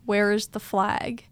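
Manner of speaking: slow, evenly voiced question; retold sleep-talk. Waking somebody up with a nonsense question, slow and voiced. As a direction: ask it slowly, fully voiced. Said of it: "I woke somebody up being like".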